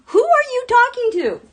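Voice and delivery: high, loud incredulous cry; baffled outcry at the post; exasperated disbelief. Real, not acted. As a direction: demand it in disbelief. Exasperated repeat.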